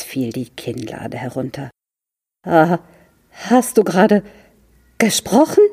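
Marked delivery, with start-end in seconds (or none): 2.5-5.6 goes up high and louder in disbelief; a boy who can't believe his ears; stunned boy. A narrator's reading. Fritz asks his turtle if she really spoke, high and amazed.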